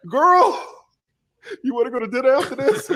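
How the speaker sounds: high, playful pick-up line breaking into laughter; mock flirting through giggles; laughing flirtation.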